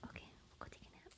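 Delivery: fast soft whisper. Real, not acted. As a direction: whisper off to nap. Whispering at nap time.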